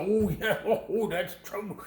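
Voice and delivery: put-on Southern whoop, pitched well up; fake Southern accent. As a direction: ham up a Southern Klansman voice. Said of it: "maybe a little fake Southern accent".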